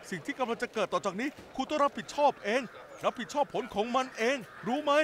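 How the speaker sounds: anger swelling line by line until it bursts; building anger. Acted. Rejected man warning his ex she'll regret it, building to a shout.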